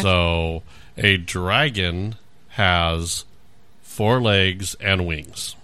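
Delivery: slow, patient explanation; slow explaining. Shown as a demonstration. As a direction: spell it out slowly. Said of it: "explaining slowly to listener".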